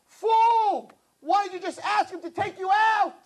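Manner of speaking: high, loud "Fool!" then pressing question; loud scolding. Acted. Minister berating prince for not asking out, loud scolding. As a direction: hammer the question home.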